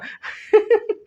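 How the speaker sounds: quick amused laugh; teacher laughs at his joke about rewards, quick laugh; short high laugh. Real, not acted.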